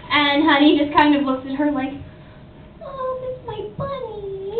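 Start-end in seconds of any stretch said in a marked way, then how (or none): 2.8-4.6 high, soft, slow little voice; cutesy character. Acted. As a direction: coo it softly, high and drawn out. Honey, cute character, claims bunny.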